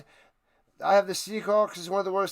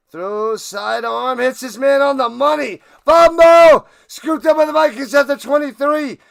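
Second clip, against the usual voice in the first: much louder, higher excited announcer call; play-by-play call; 6 semitones higher, 10 dB louder than usual. Acted. Streamer calls the fumble return live, shouting excitedly. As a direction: yell out the fumble like a broadcaster.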